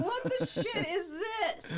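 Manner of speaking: high, slow mock dismay; hammed-up bewildered complaint; David St. Hubbins. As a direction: imitate an outraged rocker, drawn out. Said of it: "Hubbins is like".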